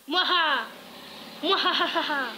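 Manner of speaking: loud evil villain laugh; mustache twirling villain. Pretend. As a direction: cackle like a cartoon baddie. Cartoon villain laugh bursts out.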